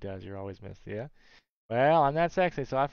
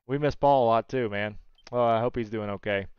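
usual speaking voice

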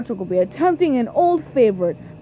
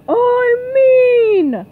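high, loud mean yell; 8 semitones higher, 5 dB louder than usual. Acted. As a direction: yell it to prove you're mean. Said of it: "Get mean".

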